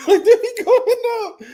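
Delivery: high laughing voice; high squealing laugh. Real, not acted. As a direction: laugh through the words. Laughing while describing yelling.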